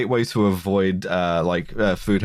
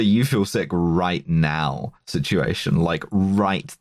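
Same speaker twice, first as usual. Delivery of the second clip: drawn-out emphasis on right then; slow it right down; slow, emphatic; 4 semitones lower, slower than usual. Real, not acted. Emphasis on immediacy.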